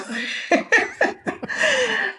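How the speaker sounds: high, breathy chuckle; real amused laugh. Real, not acted. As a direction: laugh at the memory. Laughs after retelling Casey's tease.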